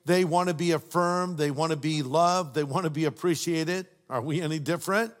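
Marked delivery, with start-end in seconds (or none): none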